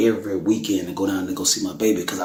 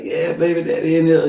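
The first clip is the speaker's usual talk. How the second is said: higher and louder, beaming; happy father reply; 8 semitones higher, 5 dB louder than usual. Retold or imitated. Father greeting his surprised daughter, joyfully raised. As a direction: answer her with delight. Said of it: "And I'm like".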